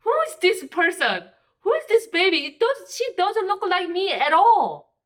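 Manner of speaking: put-on shocked new mother staring at a baby that looks nothing like her; shocked disbelief. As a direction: ask it in stunned disbelief. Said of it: "I'm like".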